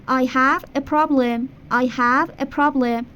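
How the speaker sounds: slow teacher demo of the sentence; slow model sentence. Shown as a demonstration. Teacher modelling English for Persian students, slow. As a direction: say it slowly for repetition. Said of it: "آفرین".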